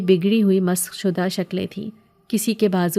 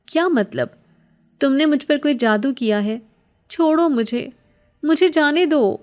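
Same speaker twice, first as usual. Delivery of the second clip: higher, pleading yet angry, louder at the end; 7 semitones higher, 3 dB louder than usual. A narrator's reading. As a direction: beg to be let go with rising anger. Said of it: "میری آواز میں التجا کے ساتھ ساتھ غصہ بھی تھا". (التجا بھری غصیلی آواز، آخر میں بلند)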